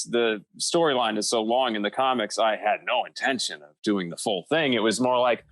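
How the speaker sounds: ordinary delivery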